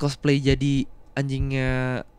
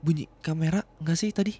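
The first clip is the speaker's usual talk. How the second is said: normal talking voice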